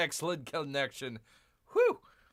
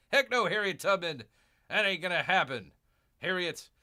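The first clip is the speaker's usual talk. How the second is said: louder, higher, firm refusal; defiant loud retort; 6 semitones higher, 5 dB louder than usual. Retold or imitated. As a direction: shout the refusal at her. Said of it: "And I'm saying".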